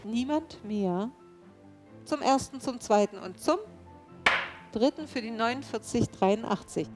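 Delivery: auctioneer. Real, not acted. Auctioneer calls bids.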